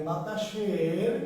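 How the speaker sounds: drawn-out word for note-taking; very slow dictation. Shown as a demonstration. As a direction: stretch the word so they can write. (খুব ধীরে শ্রুতলিখন)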